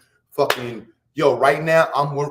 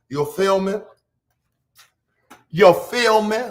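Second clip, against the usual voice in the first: higher-pitched mock country twang; put-on country accent; 6 semitones higher, slower than usual. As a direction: play up a country drawl, pitched up. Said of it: "act like I'm from country".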